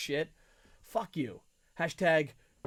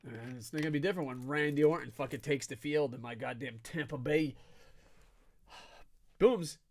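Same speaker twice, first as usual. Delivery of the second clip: ordinary delivery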